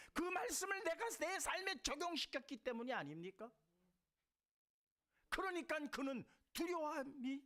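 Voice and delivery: high, loud, ringing sermon cry; full-force preaching. Acted. Pastor to the congregation, raising the voice in fervent preaching. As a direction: preach it out to the whole hall.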